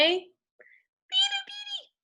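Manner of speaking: very high whispered squeak, slow; tiny squeaky whisper. Shown as a demonstration. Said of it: "So say this with me".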